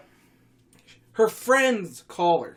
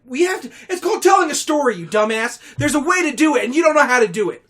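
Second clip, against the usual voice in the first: higher, louder exasperated scolding; mock angry rant; 8 semitones higher, 10 dB louder than usual. Real, not acted. Storyteller scolds heckling friends, mock angry rant. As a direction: scold the heckler with comic outrage.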